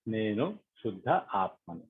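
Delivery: measured affirmations with long silences; guided meditation prayer. Acted. Leader voicing affirmations for meditators to repeat, calm and prayerful. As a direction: lead them phrase by phrase, leaving space to repeat.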